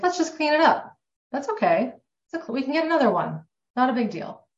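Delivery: put-on high, sing-song parent voice reassuring a child; soothing parent. Parent reassures a child about a broken glass, high and soothing. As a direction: brightly play a parent calming a child over a broken glass. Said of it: "what a parent".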